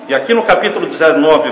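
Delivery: normal talking voice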